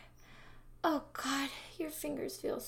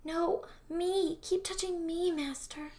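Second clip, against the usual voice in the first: eager pleading; 5 semitones higher than usual. Acted.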